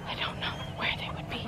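worried whisper, no voice at all. Real, not acted. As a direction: whisper it, scared they're really gone. Baby goats missing, alarmed.

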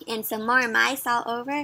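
ordinary delivery

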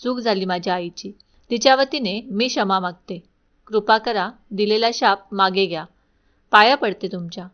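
plain reading voice

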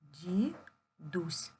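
"Дідусь" split as ді, then дусь; slow "Ді... дусь", each part separate; syllable by syllable. Shown as a demonstration. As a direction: say "Дідусь" in two syllables with a clear pause. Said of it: "Поділимо слово на склади".

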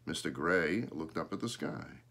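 ordinary delivery, nothing marked

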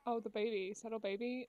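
usual speaking voice

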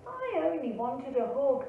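ordinary delivery

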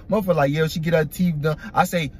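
ordinary talk

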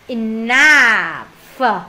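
"Enough." said slowly with a drawn-out final f; enough, ending f. Shown as a demonstration. Teacher to class, slow drawn enough ending in f. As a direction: stretch the ending of "Enough." into a long f sound. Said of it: "Đọc luôn, enough".

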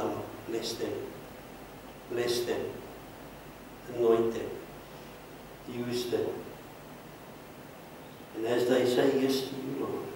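soft, hushed prayer of blessing; quiet closing prayer. Acted. Pastor quietly blessing the congregation for the new year. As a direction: pray softly, letting it settle.

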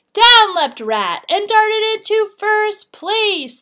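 slow, high and loud, building the moment; excited narration. A narrator's reading. Narrator to children, Rat leaps ahead, excited. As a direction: announce Rat's win like a finish line. Rat's dramatic win.

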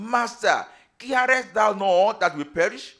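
high, loud plea to a master; urgent cry. Acted. Frightened disciples waking Jesus in the storm, urgent loud cry.

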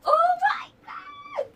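high, loud, drawn-out squeal of joy; shrieking with delight. Real, not acted. Vlogger unboxing her dream bag to viewers, shrieking. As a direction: scream it like you can't believe your luck.

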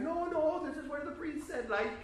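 high, loud defensive protest; flustered protest. Retold or imitated. Storyteller protests to the stranger.

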